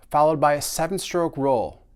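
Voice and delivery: usual speaking voice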